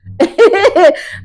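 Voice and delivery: high burst of giggling; amused laugh. Real, not acted. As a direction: laugh out loud at your own twist. Laughs at her own reveal.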